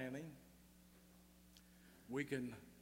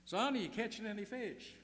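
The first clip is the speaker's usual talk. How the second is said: a mock holler pitched high and loud; hollered question; 9 semitones higher, 6 dB louder than usual. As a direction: call down the bank to the fisherman. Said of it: "he hollered down".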